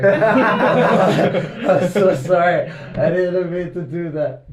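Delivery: slow, high, laughing sorry; sheepish laughing apology. Retold or imitated.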